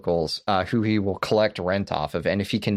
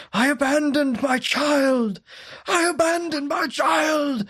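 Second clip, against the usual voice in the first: very high, slow strained scream; slower than usual. Retold or imitated. Host acting Daniel coming unglued at his baptism, screaming. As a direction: imitate a man coming unglued. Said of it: "he just starts screaming".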